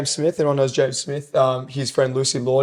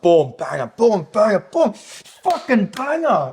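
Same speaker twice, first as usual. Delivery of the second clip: building hype chant, rising higher each time; 7 semitones higher, slower than usual. Real, not acted. To his cohost, hyping a great answer, excited chant. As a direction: build it up, boom after boom, climbing.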